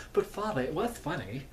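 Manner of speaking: high-pitched protesting son; imitated son's objection, starting high; Hatter. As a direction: plead up high like a scolded boy. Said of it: "then he's like".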